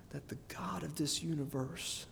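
near-whispered, reverent hush; hushed awe. Real, not acted. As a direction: drop to a soft, solemn whisper. Preacher hushes for solemn reassurance.

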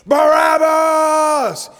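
high, slow, stretched-out mob shout; angry crowd yelling the name; crowd screaming. Retold or imitated. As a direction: roar the name as thousands would. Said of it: "emotional voices screaming".

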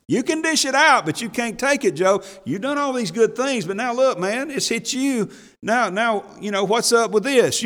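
high, mock-harsh Eliphaz scolding; harsh rebuke. Eliphaz scolding suffering Job with mock harshness.

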